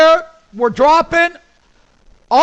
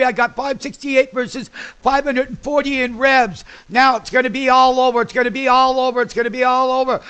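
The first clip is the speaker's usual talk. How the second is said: excited; 5 semitones higher than usual. Real, not acted. Trader reporting earnings to the listeners, excited. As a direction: spit the numbers out as they hit.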